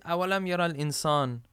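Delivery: quick recited Arabic verse; Quran recitation. Acted. Preacher reciting a verse to the congregation, quick chant.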